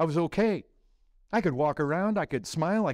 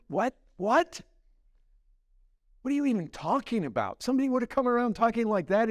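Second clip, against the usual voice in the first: higher-pitched, incredulous rush of questions; baffled disbelief; 6 semitones higher, faster than usual. Acted. Unbelievers hearing talk of loving Christ, incredulous. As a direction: react as if it's utter nonsense.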